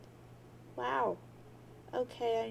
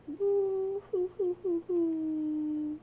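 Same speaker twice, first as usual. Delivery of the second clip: loud, slow drawn-out boo hoo wail; hammed-up slow sob; mock wailing; 5 semitones higher, 5 dB louder, much slower than usual. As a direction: wail it like an overdone cartoon cry.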